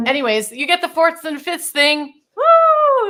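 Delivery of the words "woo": high, loud joyful woo; a burst of delight; excited whoop. Real, not acted. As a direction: cheer it out. Excited after exercise.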